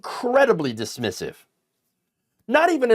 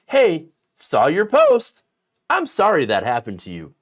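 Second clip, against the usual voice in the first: mock-casual message; 5 dB louder than usual. Commentator quoting a private message to his audience, mock-casual. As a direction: read the message with exaggerated casualness. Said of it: "He privately messaged Mister Delancey saying this".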